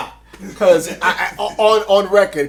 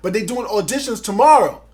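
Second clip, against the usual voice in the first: excited; 5 semitones higher, 9 dB louder than usual. Retold or imitated. Friend hyping the audition news.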